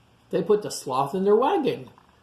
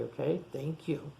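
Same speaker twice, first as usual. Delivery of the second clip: ordinary delivery, nothing marked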